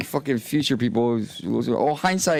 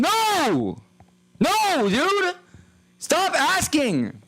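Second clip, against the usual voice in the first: exasperated shout; much slower than usual. Acted. Streamer shouting down chat asking about bombs, high and fed up. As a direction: cut the chat off hard, drawn out at the end. Yelling back at chat's bomb question.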